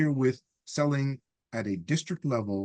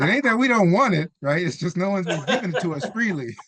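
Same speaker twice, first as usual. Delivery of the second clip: laughing; 7 semitones higher, 3 dB louder than usual. Real, not acted. Laughing about needing money.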